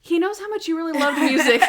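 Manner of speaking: high, loud, breaking into laughter; loud mock praise collapsing into laughs; laughing through quote. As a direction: act it out while cracking up. Breaks up while quoting Natalie.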